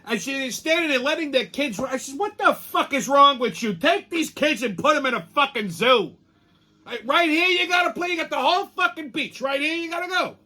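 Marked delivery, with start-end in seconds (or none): 2.1-10.4 mock angry yell. Yelling at beach parents.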